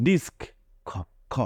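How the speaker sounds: ডিস্ক ending on a hard খ; final c as k. Shown as a demonstration. Teacher stressing the last sound to the class. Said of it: "উচ্চারণটা কিন্তু হচ্ছে".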